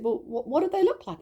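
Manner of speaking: high, eager retold question; eager curiosity. Mum asking daughter about the angels, eagerly curious. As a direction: re-enact wide-eyed questioning of a child.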